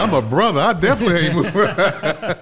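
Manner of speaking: loud, high, laughing refusal; emphatic refusal. Retold or imitated. Telling how he refused to budge, loud comic protest. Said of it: "So I was like".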